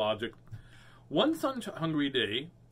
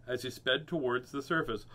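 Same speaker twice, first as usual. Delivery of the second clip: plain reading voice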